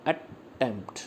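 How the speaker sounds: Attempt split softly into two separate syllables, at-tempt. Shown as a demonstration. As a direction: pause between the two halves of attempt. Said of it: "तो हम कैसे बोलते हैं इसको".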